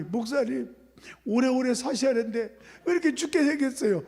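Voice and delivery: high mock-tearful lament; imitated tearful wail. Congregant crying over the pastor's health, imitated wail. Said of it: "저를 보고 막 울어".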